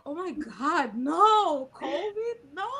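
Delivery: high-pitched, hammed-up wail of protest; mock dismay. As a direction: feign horror at bad news. Replays feigned dismay on phone.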